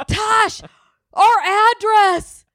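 high, loud, slow mock confusion; hammed-up airhead version of herself; mock dumb self. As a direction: play yourself dim, way up high and drawn out. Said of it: "you think I'm that stupid that I'd start being like".

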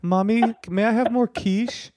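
put-on high, slow, prim little-boy voice; posh little boy. Host mocking the guest's future privileged son, high prim child voice. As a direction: lisp it like a pampered child. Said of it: "boy who's gonna come in and say".

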